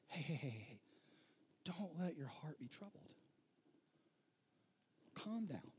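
soft, calming hey-heys; quiet them down tenderly; gentle reassurance. Retold or imitated. Jesus calming people overwhelmed by decisions, softly.

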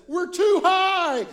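high, loud, slow panic; mock terrified cry; panicked. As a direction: wail it like a scared kid on takeoff. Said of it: "immediately says".

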